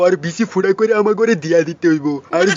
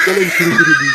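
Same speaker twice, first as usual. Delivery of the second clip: rallying shout; 13 semitones higher than usual. Acted.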